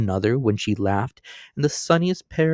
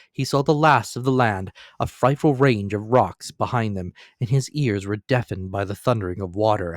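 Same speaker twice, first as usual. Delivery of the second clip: ordinary delivery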